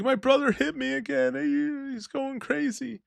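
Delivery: high-pitched imitation of a crying girlfriend; hammed-up tearful complaint; mock crying. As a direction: retell her tearful call in a high whine. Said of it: "She's crying".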